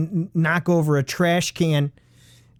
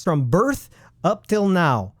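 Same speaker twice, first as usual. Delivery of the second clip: usual speaking voice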